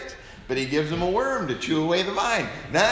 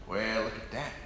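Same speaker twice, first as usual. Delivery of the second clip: a hint of a gruff old westerner, softer and lower; Bruce Stern; 7 semitones lower, 11 dB quieter than usual. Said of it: "remember Bruce Stern?".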